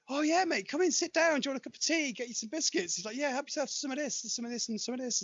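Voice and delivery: hammed-up chummy visitor; high mock host voice. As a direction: play the dazed guest acting host.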